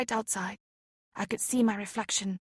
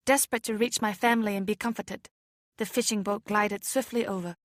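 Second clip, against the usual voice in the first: plain reading voice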